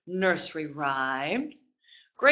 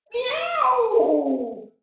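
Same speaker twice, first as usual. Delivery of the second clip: cat meow; 13 semitones higher than usual. Pretend. Teacher meows like a cat in kids yoga. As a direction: mew like a kitten for the kids. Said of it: "That's what a cat says".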